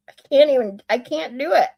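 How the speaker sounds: ordinary talk, nothing marked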